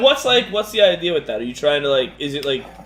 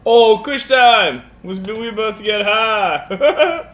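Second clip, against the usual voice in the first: excited cheer; 7 semitones higher, 5 dB louder, much slower than usual. Real, not acted. Joking about getting high.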